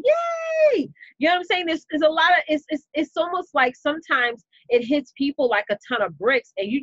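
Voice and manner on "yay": high, loud, drawn-out "yay"; squealed mock celebration; mock cheer. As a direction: cheer like an over-thrilled crowd. Imagined crowd cheering the news.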